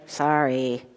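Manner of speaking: low, soft, grudging mock sorry; half-hearted apology. Shown as a demonstration. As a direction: mumble a sorry you don't mean. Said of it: "sometimes you're just like".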